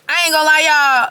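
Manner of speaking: loud, high I ain't gonna lie; loud admission. Real, not acted. Telling the viewers, a loud high admission. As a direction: call it out to y'all.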